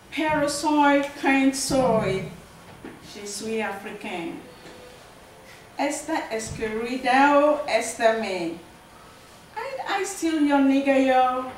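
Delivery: loud, declaimed multilingual verse; emphatic poetry performance; poem recital. Acted. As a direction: call the lines out boldly, phrase by phrase. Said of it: "let me go ahead and read the poems".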